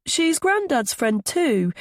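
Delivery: put-on slow, high child character voice; small boy's high voice. A narrator's reading. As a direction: chime in slowly like a little boy. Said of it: "Milo's high voice".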